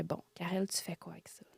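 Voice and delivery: se parle tout bas, sans voix; chuchotement à soi. Retold or imitated. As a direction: murmure une question à elle-même. Se parle à elle-même. (chuchote pour elle-même)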